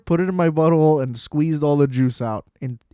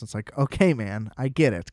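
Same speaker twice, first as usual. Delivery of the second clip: ordinary delivery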